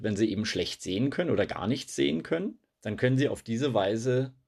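usual speaking voice

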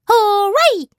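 high, loud squawky parrot; parrot squawk. Acted. Parrot calls out from inside the crate, high squawk. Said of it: "ist es ein Papagei".

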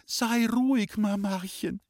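put-on high little-girl voice, calling to her mother. A narrator's reading. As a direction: call out to calm Mama. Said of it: "hat gerufen". (hoch gerufen, als beruhigendes Mädchen)

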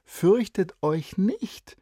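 high and slow, each word stretched; girl teasingly quotes the angel; lively girl quoting. Acted. Girl quoting the angel to encourage her friend, stretched.

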